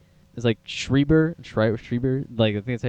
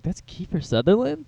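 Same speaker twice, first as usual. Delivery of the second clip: surprised realization; 7 semitones higher than usual. Retold or imitated. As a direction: quote your own disbelief, voice rising. Acting out surprised realization while watching.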